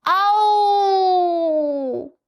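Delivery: high loud yelp; howl of pain. A narrator's reading. Donkey falls and howls in pain. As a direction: cry out from a fall. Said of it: "he howled".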